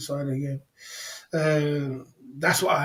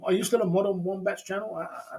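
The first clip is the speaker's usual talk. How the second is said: ordinary talk, nothing marked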